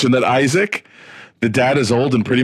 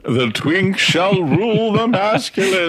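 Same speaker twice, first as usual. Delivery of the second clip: slow, higher put-on proclamation; mock decree; 4 semitones higher, slower than usual. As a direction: intone it like a grand mock prophecy. Mock decree summing up the story.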